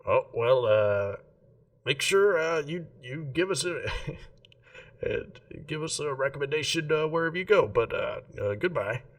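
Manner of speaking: a chirpy small smith seeing a customer off; gnome shopkeeper. Acted.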